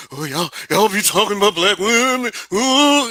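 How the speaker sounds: lampoon the whining critics; mocking whiny critic.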